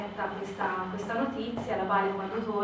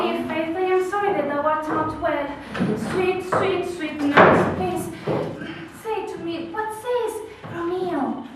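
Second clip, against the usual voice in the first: Juliet sweetly pleading with the nurse, a little higher; coaxing Juliet; 9 semitones higher, 8 dB louder than usual. Acted. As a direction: wheedle the answer out of her.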